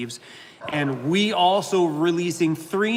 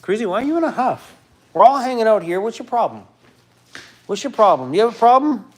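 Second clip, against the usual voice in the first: higher, playful pet-scolding voice; talking to the dog, higher and teasing; 6 semitones higher, 5 dB louder than usual. Real, not acted. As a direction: needle the sulking dog, pitch up.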